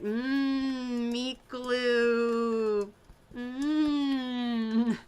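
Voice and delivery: lightly voiced relish, "Mmm, meat glue"; savoring food. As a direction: hum over it like a tasty treat. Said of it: "But I'm like".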